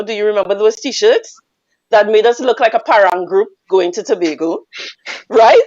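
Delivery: ordinary delivery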